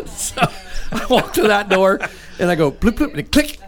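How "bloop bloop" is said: key card bloop. Retold or imitated. Imitating the key card beep again.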